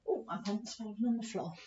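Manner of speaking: quick, hushed whisper; teacher's quick embarrassed aside. Real, not acted. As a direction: mutter it under the breath. Aside to class.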